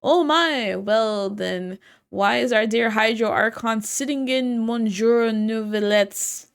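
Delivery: loud hammed-up French accent. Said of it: "If only I could do a French accent".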